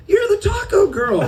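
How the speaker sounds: high, laughing shout of recognition; surprised shout. Retold or imitated. Meeting the taco girl again, high laughing shout. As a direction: blurt it out, suddenly recognising her. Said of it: "I go".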